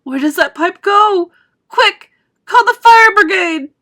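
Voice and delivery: high, loud panicked yell. A narrator's reading. Mother yells for the fire brigade, panicked. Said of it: "yelled Missus Gloop".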